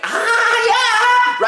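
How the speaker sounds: high-pitched crazed shriek, played for laughs; comic unhinged squeal; mock lunatic scream. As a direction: let out a hammed-up high mad wail. Mock lunatic outburst after insane remark.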